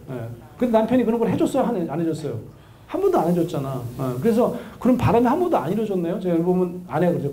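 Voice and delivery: ordinary talk, nothing marked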